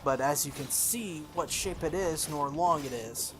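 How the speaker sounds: ordinary delivery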